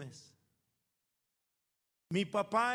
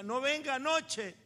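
louder, higher mom telling him off; imitating a scolding mom; mock scolding mother; 7 semitones higher, 5 dB louder than usual. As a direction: bark the order like a strict mother.

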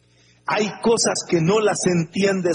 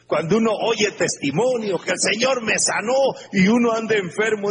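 ordinary delivery

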